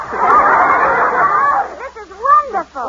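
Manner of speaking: happy exclamation; overjoyed. Acted. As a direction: gush with joy. Rejoices at winning.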